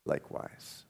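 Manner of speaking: breathy whisper, no voice at all; whispered likewise. Acted. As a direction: barely breathe the word out. Disciples answering in hushed chorus.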